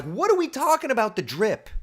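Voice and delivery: high-pitched incredulous question; baffled question. Retold or imitated. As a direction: ask it in mock bewilderment. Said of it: "And I'm like".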